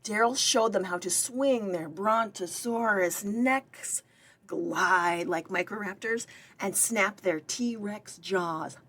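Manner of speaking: ordinary delivery, nothing marked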